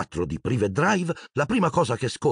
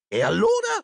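sharp outburst. A narrator's reading. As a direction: bite his head off. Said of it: "sbottò la signora Dursley".

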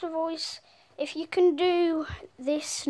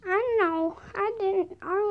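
Elmo voice; 6 semitones higher than usual. Acted. Elmo answers the question.

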